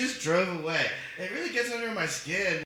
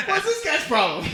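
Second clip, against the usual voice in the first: mock innocent question; 10 semitones higher, 4 dB louder than usual. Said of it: "I just go".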